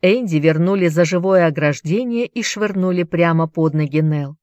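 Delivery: ordinary delivery, nothing marked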